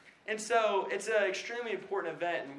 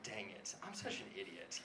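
muttered self-reproach; 7 semitones lower, 14 dB quieter than usual. Retold or imitated. Retold self-reproach after realizing.

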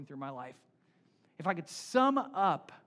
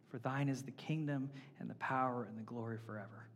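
closing prayer; 4 semitones lower than usual. Acted.

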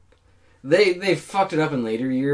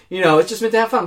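normal talking voice

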